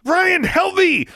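high, loud slow scream for help. Acted. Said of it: "she screamed".

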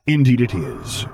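easy agreement. A narrator's reading. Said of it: "he agreed easily".

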